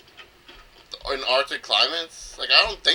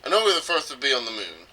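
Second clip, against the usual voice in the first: normal talking voice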